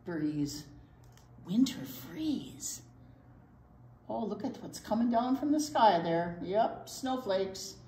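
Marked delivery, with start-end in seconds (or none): none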